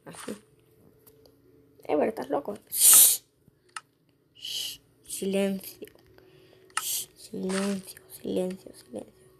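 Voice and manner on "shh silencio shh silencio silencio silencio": slow, softer hush fading to a whisper. Pretend. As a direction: hush them slowly into silence. Pide silencio, va apagándose. (shh, silencio cada vez más bajo)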